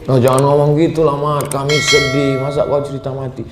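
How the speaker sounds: high-pitched, upset friends objecting; voicing dismayed friends; pleading protest. Friends protest his talk of death.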